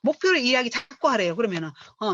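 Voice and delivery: ordinary delivery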